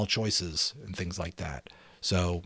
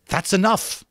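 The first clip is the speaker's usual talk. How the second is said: a curt, raised shutdown; sharp; 12 semitones higher, 8 dB louder than usual. Acted. Ralph silencing Piggy, sharp and louder. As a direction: cut him off firmly. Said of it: "said Ralph sharply".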